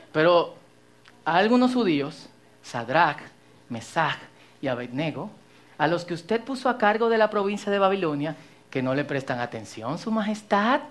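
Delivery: hushed tattletale reading; gossip voice. Acted. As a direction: read it like sly gossip. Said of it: "voz de chismoso".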